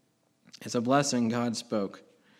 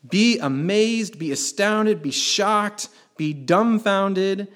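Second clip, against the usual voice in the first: higher and louder, slow and stretched; building amazement; 6 semitones higher, 5 dB louder, slower than usual. Acted. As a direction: let each phrase land bigger. Preacher piling up synonyms.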